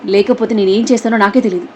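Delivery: ordinary talk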